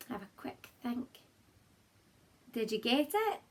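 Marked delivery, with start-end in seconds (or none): 0.1-1.1 breathy secret whisper, voice off. Real, not acted. Teacher hushing the class while they think, whispered. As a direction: hush it like a little secret.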